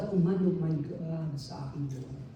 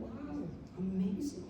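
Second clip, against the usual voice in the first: awed whisper; 13 dB quieter, slower than usual. Retold or imitated. Quoted awe, breathy and slow.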